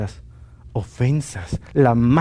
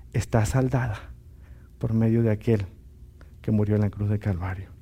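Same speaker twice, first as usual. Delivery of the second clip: tender; 6 semitones lower, 8 dB quieter than usual. Acted. Lord's gentle word of forgiveness.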